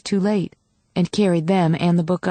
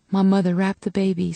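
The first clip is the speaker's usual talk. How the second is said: ordinary delivery, nothing marked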